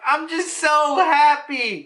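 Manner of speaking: high, drawn-out happy blubbering; mock happy crying. Narrator voices both characters crying, mock tearful joy. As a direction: stretch it out high, as if tearfully overjoyed. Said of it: "they're both crying and they're like".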